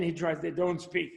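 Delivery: ordinary talk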